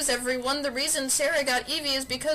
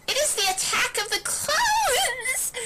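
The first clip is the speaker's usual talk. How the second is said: laughing quip. Acted. Joke line with laugh.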